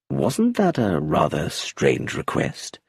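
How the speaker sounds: low, slow, gentle question; gently. Acted. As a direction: ask it gently, without accusing. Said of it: "said Colonel Race gently".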